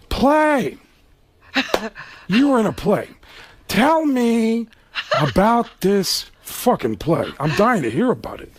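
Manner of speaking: Pacino impression. Pacino mocking Alec about the play, sarcastic. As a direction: sneer it like Pacino pretending interest. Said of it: "And he goes".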